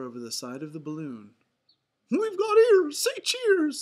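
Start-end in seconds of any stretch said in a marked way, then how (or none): 2.1-3.8 very high, loud falsetto mouse; rally friends in a cartoon falsetto; Mickey Mouse. A narrator's reading. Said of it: "said Mickey".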